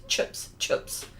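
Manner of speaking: 'chips' said as 'chups'; New Zealand 'chips'. Shown as a demonstration. Host imitating Kiwi friends, 'chips' sounding like chups. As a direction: Kiwi short i in 'chips', toward a u. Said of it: "They go chips".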